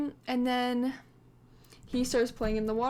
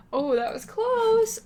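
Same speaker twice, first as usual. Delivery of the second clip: relieved gasp; 11 semitones higher, 8 dB louder, slower than usual. Real, not acted. Close call in game.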